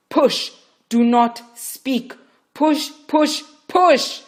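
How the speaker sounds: loud, high repeated push; vehement shouts. Acted. Midwife yelling at Rachel to push. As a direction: drive her with each shout. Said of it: "Yima's vehemence".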